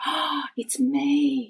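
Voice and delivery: high, soft, drawn-out gasp; hushed, stretched-out epiphany; dawning realisation. Retold or imitated. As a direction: let it sink in, high and slow. Acted sudden realisation about herself.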